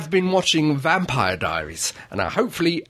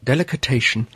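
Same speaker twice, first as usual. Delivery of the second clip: announcer intro; 2 semitones lower than usual. Acted. Host announcement.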